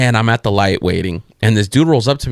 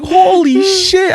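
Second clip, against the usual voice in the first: high, very drawn-out astonishment; laughing mock shock; amazed; 4 dB louder, much slower than usual. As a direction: stretch it out in amused disbelief. Retold amazed reaction to friend.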